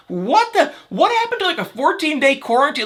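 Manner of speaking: high, incredulous squeal of disbelief; shocked and baffled, pitch way up. Retold or imitated. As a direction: react like you can't believe it. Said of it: "I was like".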